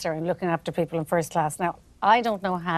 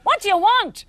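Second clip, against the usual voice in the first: high, sharp mock snap at a passenger; rude hostess snapping; 14 semitones higher, 4 dB louder than usual. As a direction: bark it like a hostess who can't be bothered. Imitating rude sister to passengers.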